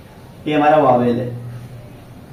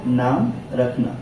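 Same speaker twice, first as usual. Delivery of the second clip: slow emphatic; 5 semitones lower, 5 dB quieter, slower than usual. Shown as a demonstration. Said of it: "और क्या होता है".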